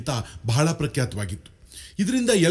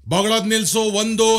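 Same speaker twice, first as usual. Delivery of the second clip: high, forceful officer's order; military command; 6 semitones higher, 4 dB louder than usual. Acted. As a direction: order the dog to stop, counting like an officer. Army officer orders dog, gun drawn.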